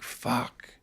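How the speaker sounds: soft, dropped swear under the breath; a sinking-heart mutter; hushed curse. Retold or imitated. Reliving the heroin landing on his lap, a soft curse. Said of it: "I'm like".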